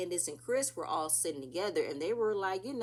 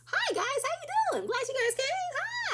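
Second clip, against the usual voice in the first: high, sugary hostess voice pitched way up; mock Wendy greeting; 4 dB louder, slower than usual. Recapper mimics Wendy greeting the guests in a high voice. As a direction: play the overly sweet host greeting guests. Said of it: "Wendy comes in and says".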